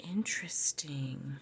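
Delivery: slow intrigued whisper; slow whispered musing. Real, not acted. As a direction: breathe it out slowly. Quiet reaction to drawn card.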